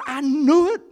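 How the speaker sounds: high, loud cry of I knew it; Jonah's outburst. Acted. Jonah crying out to God in frustration, high and loud. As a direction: blurt it out, caught out and indignant.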